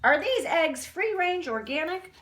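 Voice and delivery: high, put-on voice for a picky customer; fussy bear asking. Bear asks Missus Goose about her eggs, high put-on voice. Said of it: "And he says to Missus Goose".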